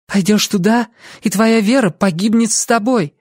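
high, determined warning; resolute young woman. A narrator's reading. Lucy calling after Ansel as last resort, resolute. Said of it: "решительно заявила Люси".

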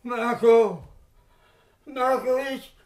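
terrified man begging his friend; frightened plea. Acted. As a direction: plead in rising fear.

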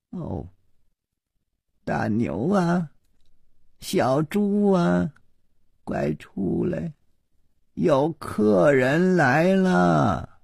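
high, slow, long-drawn call to the next room. A narrator's reading. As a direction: call them out, stretching the names without real volume. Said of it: "立刻就喊道". (拖长高音喊人出来)

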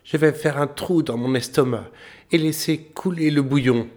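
high boasting voice; boastful little tailor. A narrator's reading. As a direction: brag it in a high voice. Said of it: "dit le tailleur".

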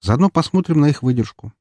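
usual speaking voice

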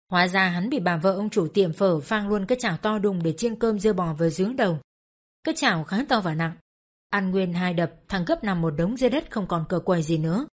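usual speaking voice

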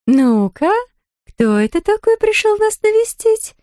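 high, sing-song doll voice; tiny doll-like voice. A narrator's reading. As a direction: coo to the baby in a tiny high voice. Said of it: "пропела Марни тоненьким, каким-то кукольным голоском".

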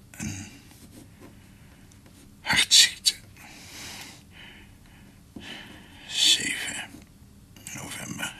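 fluisterend, ijlend van de pijn; dying delirium. Acted. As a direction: ijl zacht als een stervende. Dying man's delirious whispered vision. (ijlend gefluisterd)

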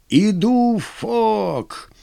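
high hoarse cry; hoarse shout. Acted. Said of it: "всё-таки хрипло выкрикнул Брянов". (хриплый выкрик)

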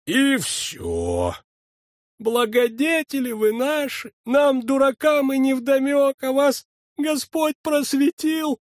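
high pleading church sexton; sexton's voice. A narrator's reading. Дьячок льстит фельдшеру. (высокий льстивый голос дьячка)

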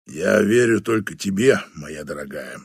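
slow, low, soft boss; fond old general, slow and low. Acted. Batanda replies to Flavia.

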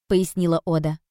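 ordinary delivery, nothing marked

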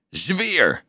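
a hint of a barked order; mild shout. A narrator's reading. Officer shouting at the trembling boy, mild shout. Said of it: "schreeuwde hij".

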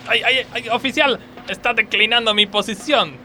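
pained cries. Acted. Worker cries to the officer twisting his arm. Being arrested by police.

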